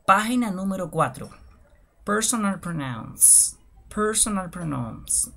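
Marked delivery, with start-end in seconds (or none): 2.0-5.3 drawn-out careful model for learners; slow demonstration. Shown as a demonstration. Teacher introducing personal pronouns, slow and deliberate. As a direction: pronounce the phrase slowly, syllable by syllable. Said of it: "lo voy a hacer de manera lenta y despacio".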